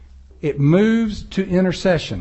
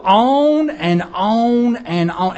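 long, stretched-out "on"s; drawn-out droning; 2 semitones higher, much slower than usual. Acted. Preacher showing how we pray too long, drawn out. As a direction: drag it out as if it never ends. Acts out endless praying.

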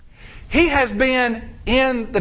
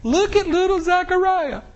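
relatives cooing; 8 semitones higher than usual. Acted. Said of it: "everybody's going".

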